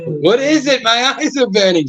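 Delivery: high-pitched panicked squeal; panicked high mock cry. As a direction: shriek like the sun burns you. Mock British Beatle panicking at sun.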